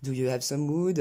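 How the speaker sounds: "wood" with a long French ou; French-accented mispronunciation. Shown as a demonstration. Teacher showing learners' ou habit, French-accented mistake. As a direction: make "wood" rhyme with food, French style. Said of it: "tendance à prononcer en ou".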